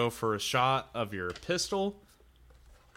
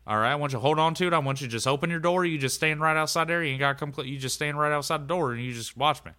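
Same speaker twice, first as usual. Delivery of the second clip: a touch higher, louder trucker voice; Doug giving orders; 6 semitones higher, 4 dB louder, faster than usual. Acted. Doug telling George to grab the pistol, a bit raised. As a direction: give firm quick orders to your buddy. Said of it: "and he's like".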